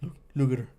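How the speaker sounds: usual speaking voice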